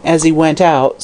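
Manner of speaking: ordinary delivery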